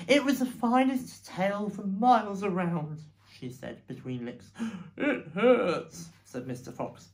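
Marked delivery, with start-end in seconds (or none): 0.1-3.0 louder, higher, lightly voiced Mrs Fox; a touch of wifely lament; lamenting mother fox. Mrs Fox laments between licks. 5.0-6.1 loud, high cry of hurt; acted yelp of pain; pained complaint. Wounded Mr Fox complains of pain.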